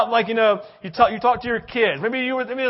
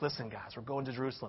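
much softer, lower confiding words; confiding hush; 7 semitones lower, 14 dB quieter, faster than usual. Acted. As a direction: share a secret with the twelve. Jesus confiding a secret.

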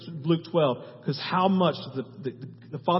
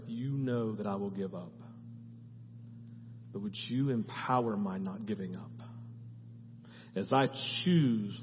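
quiet sincere prayer; 4 semitones lower, 5 dB quieter than usual. Shown as a demonstration. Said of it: "And this is the prayer".

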